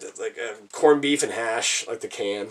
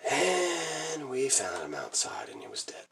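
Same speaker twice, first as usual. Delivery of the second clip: hushed grief; 9 dB quieter than usual. Real, not acted. Telling how the childhood cat died, hushed and grieving. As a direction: break bad news softly, voice sinking away. Reveals the pet's death.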